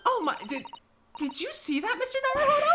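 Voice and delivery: very high, astonished assistant voice; acted high-pitched alarm; startled. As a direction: gasp it to your companion. Assistant startled by shaking case.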